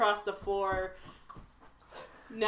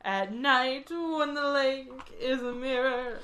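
high, slow, dreamy line; dreamy recitation; 4 semitones higher, slower than usual. Pretend. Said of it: "over the garden wall joke".

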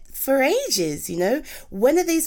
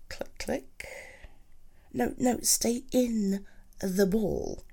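hushed whisper to the game; soft whispered coaxing; 7 dB quieter, slower than usual. Acted. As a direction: breathe it under your breath, slowly. Talking to a game character.

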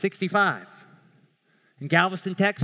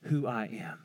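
low, slow, weighty declaration; God; 7 semitones lower, 5 dB quieter, slower than usual. Acted. Preacher quoting God to Moses, solemn and low. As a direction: speak softly and low, pausing mid-phrase. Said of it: "And God says".

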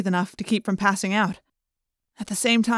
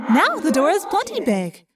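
wry Rachel voice; 7 semitones higher than usual. A narrator's reading. Rachel, wry after smashing.

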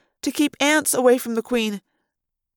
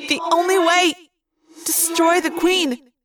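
high, urgent thought-voice, slowing on the order; Rachel; 5 semitones higher than usual. A narrator's reading. Rachel orders the Queen destroyed, urgent. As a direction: press the command home. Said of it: "Rachel's voice said".

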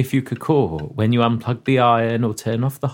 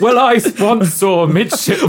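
laughing through line; 8 semitones higher, 5 dB louder than usual. Acted. Reading line while laughing.

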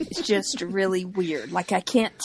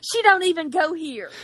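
higher, louder quoted line; movie quote; 7 semitones higher, 4 dB louder than usual. Retold or imitated. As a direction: deliver it like the famous film line. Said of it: "reminded me of the".

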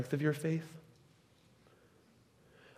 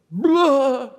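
a high, loud blah bursting out; sudden blurt; 9 semitones higher, 8 dB louder than usual. Shown as a demonstration. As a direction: let the blah pop out of you. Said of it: "blurts out".

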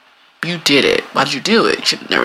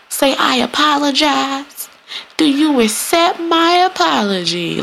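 put-on teacher to kids; 9 semitones higher, slower than usual. Mimicking teacher instructing kids.

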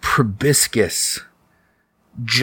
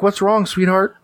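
high-pitched mother voice; high, tender mom checking on her kid; concerned mom; 10 semitones higher than usual. Acted. Mom running in to crying child, concerned, high voice. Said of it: "your mom comes running in".

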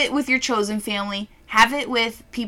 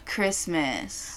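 normal talking voice

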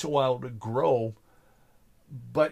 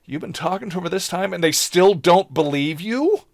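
mock disbelief, higher and building louder; incredulous; 6 semitones higher, 7 dB louder than usual. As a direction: act out amazed disbelief, rising to a loud question. Retold ambassador exchange, disbelief.

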